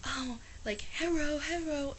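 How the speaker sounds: "hello" said as heh-roh, high and mocking; mocking Americans. Vlogger mimicking Americans who mock Japanese speakers, mocking tone. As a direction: parody a fake Japanese accent on "hello". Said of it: "hearing people from back in America like".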